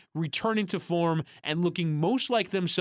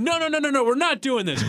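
high, loud mock outburst of no no no; furious press officer; 11 semitones higher, 5 dB louder than usual. Press officer shutting down a phone translation, furious. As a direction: snap at the reporter in mock fury. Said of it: "he was furious".

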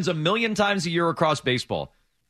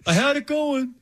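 whiny voice; 8 semitones higher than usual. Retold or imitated. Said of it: "quoting Price whining".

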